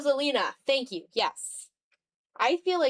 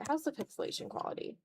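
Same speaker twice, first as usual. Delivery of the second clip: fast whispered question to herself; softly muttered aside while checking the pixels; quick whisper; 6 semitones higher, 6 dB quieter, faster than usual. Real, not acted. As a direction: rush a whisper, checking the picture.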